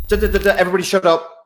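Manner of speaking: cartoon lizard brain ordering everyone to shut up; high-pitched rushed reptile commander; bossy Mister Lizard. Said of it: "so Mister Lizard goes".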